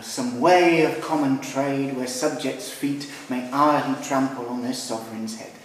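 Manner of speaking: slow, measured English verse reading; slow verse recitation. Shown as a demonstration. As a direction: read the Shakespeare line slowly, keeping the iambic beat. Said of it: "un vers de dix syllabes de Shakespeare".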